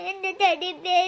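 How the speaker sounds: put-on slow, sobbing child's wail; crying. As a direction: break the words up with sobs. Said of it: "നീ ഇങ്ങനെ കരയല്ലേ?".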